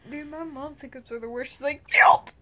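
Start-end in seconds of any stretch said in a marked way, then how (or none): 1.9-2.3 sharp loud yelp of a hiccup. Retold or imitated. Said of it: "That's her hiccup".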